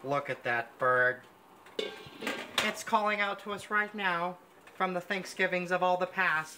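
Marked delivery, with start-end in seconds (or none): none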